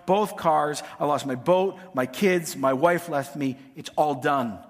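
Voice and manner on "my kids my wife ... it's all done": ordinary talk, nothing marked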